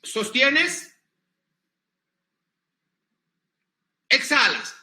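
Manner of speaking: slow, spaced breathing instructions; guided breathing cues. Shown as a demonstration. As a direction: lead the breath, pausing long between commands. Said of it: "Una, dos, tres".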